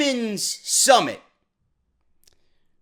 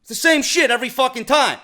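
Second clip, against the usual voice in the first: usual speaking voice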